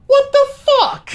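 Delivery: squeaky high disbelief; high-pitched what-the-hell; incredulous. Real, not acted. Reacting to no secret identity.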